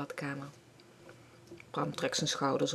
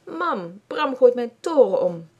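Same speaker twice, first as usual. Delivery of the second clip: put-on high, loud child's call for mum; little sister shouting for mum that her tower fell; calling loudly; 6 semitones higher, 7 dB louder, slower than usual. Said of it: "Marloes roept heel hard".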